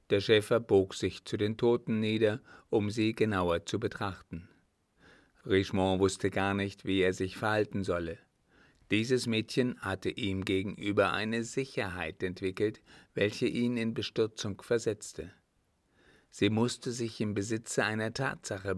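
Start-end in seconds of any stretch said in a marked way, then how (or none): none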